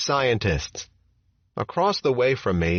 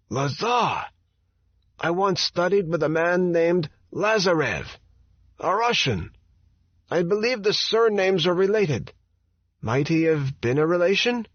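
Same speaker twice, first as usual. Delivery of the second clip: put-on higher, curious old physicist with an Eastern European accent; 8 semitones higher than usual. A narrator's reading. Teller asking Lazar about his surname, Eastern European accent. Teller voiced in memoir reading.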